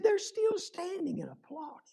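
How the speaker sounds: high, softened, amazed protest; incredulous insistence. Retold or imitated. Friend showing the violinist the standing ovation, high and insistent.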